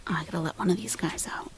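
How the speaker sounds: quick whispered aside; whispering. Real, not acted. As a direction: rush it under your breath. Aside while busy.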